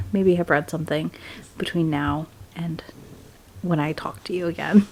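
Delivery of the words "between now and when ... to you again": sleepy whispered sign-off. Real, not acted. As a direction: whisper it like you're tucking in.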